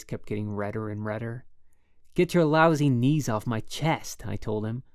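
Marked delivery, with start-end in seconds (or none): 2.1-4.3 loud, slow, furious plea; angry, near tears. A narrator's reading. Holden pinned under his roommate, loud angry plea. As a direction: spit it out, close to bawling. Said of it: "I was almost bawling".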